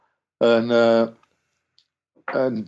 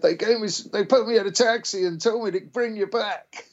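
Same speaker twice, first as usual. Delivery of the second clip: high panicky friend; 11 semitones higher than usual. Retold or imitated. Friend explaining the taxi.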